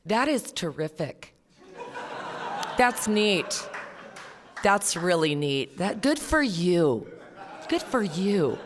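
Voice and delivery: slow, mock-gushing praise; imitating non-alcoholics gushing. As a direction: drawl out the patronising praise. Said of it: "they say things like".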